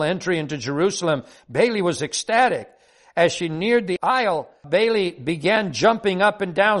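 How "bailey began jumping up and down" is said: usual speaking voice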